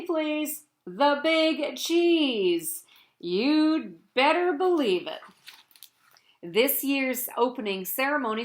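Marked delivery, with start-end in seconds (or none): 0.9-5.2 very slow, louder "The Big Cheese"; milk the title for applause; drawn-out title. Acted. Cheese boasting about trophies, slow and loud. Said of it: "say it with me".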